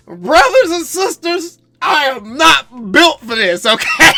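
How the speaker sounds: high, slow wailing lament to the congregation; hammed-up sob, dragging every word; mock crying. Fan wailing to viewers about the tanking team, mock crying.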